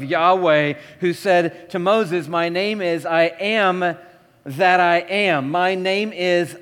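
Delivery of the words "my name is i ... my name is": solemn slow. Pretend. God's words, slowed.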